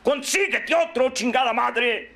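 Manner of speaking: high, emphatic podium command; shouted exhortation. Acted. Speaker tells the martyr to move on, shouted exhortation. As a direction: fire the order out high, then stretch it.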